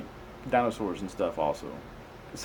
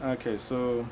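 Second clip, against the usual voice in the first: normal talking voice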